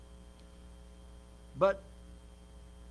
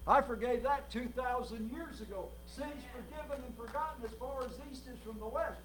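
ordinary delivery

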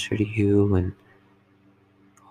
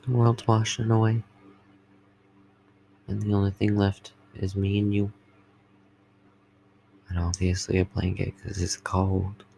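sweet nothings. Acted. Sweet talk to partner.

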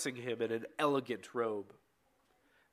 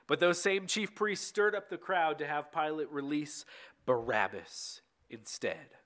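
ordinary delivery

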